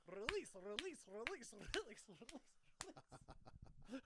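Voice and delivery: high, repeated Russian command; a nurse slapping a patient's butt, demanding; Russian nurse impression. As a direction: chant it like a stern Russian nurse. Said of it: "talked like my Russian act".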